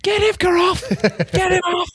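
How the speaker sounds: high shouted angry coach; Rodney Eade rant. Retold or imitated. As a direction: yell it like an irate coach. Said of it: "Rodney Eade style just".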